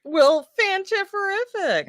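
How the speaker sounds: high, goofy put-on voice; silly high voice. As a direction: squeal it like a cartoon. Mocking echo in silly voice.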